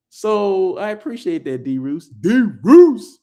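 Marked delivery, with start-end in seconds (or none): 2.1-3.2 loud, high shout-out; calling out. Real, not acted. Host hailing the viewer who got tickets, calling out. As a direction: call the name out loud and high.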